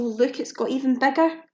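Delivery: plain reading voice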